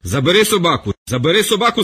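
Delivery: terrified official shrieks at the sailor; shrieking. Acted. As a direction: scream for someone to call off the dog. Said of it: "вереснув Валігура".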